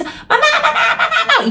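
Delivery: put-on very high, escalating kid's whine, laughing; shrieking child. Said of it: "el niño no se calla, el niño empieza". (niño chillón cada vez más agudo, entre risas)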